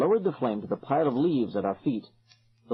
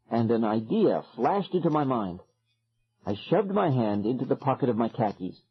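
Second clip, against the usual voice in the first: usual speaking voice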